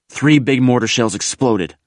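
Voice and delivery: ordinary delivery, nothing marked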